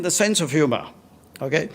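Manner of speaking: usual speaking voice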